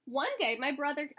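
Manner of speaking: usual speaking voice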